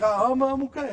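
put-on breezy kid brushing it off; young son. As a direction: bounce it like a happy eight-year-old. Voicing his young son.

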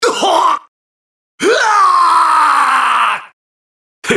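high, long battle yell; battle scream. Acted. Game fighter crying out in combat, long high scream. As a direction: cry out in a drawn-out high yell.

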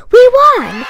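a loud, high burst of joy; triumphant cheer. Acted. Kid shouting to the diary about winning. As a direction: shout it like you just won the race.

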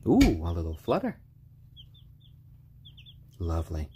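ordinary delivery

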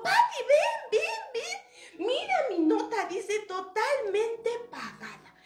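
put-on high, loud, eager kid; excited calling out. Toñito calling Pati to see the note, thrilled and high. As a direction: call a friend over, bursting with news. Excited boy calls friend over.

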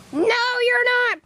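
high, loud and slowed no; shouted denial. Acted. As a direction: shut him down with a yell. Bird rejects Snivy's claim.

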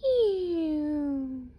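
a long disgusted turtle ew. Acted. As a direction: let the turtle recoil in disgust. Turtle rejects Hedgehog.

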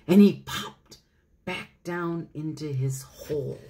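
ordinary delivery, nothing marked

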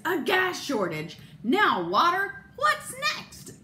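rising, exasperated outburst; hammed-up husband's alarm, climbing high; mock outraged husband; exclaiming.

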